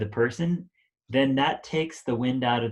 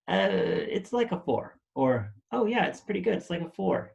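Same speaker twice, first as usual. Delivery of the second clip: lukewarm, unsure rating in a much higher voice; hesitant partner; 8 semitones higher than usual. Retold or imitated. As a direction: play the partner who is only half satisfied, pitched well up.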